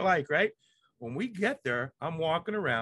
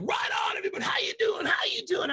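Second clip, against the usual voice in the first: hype host shout. Pretend. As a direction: fire up the crowd like a live host. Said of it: "I'm just doing my Rick Nyer live rage side".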